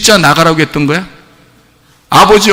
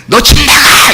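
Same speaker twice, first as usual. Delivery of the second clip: mock angry yell, raised pitch; parent's shout; 7 semitones higher, 4 dB louder, slower than usual. Old-time parent throwing a child out, mock shouting. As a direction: imitate a parent yelling across the neighborhood. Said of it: "저녁마다 들리는 소리예요".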